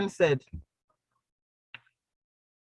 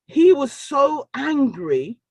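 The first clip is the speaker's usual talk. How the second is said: slow, loud shout with a higher pitch; emphatic shout; 5 semitones higher, 4 dB louder, much slower than usual. Real, not acted. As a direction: hammer home how angry he was. Emphasising the consultant's anger.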